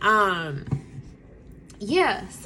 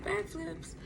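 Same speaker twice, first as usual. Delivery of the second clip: high, soft incredulous echo; can't believe what she heard; mother repeating her baby's boast in disbelief; disbelieving echo; 8 semitones higher, 13 dB quieter than usual. Retold or imitated.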